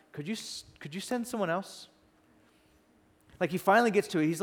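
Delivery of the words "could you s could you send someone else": soft, hesitant plea lifting upward; timid request. Acted. Moses finally asks God to send another, timid and hushed. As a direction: ask meekly, barely daring to say it.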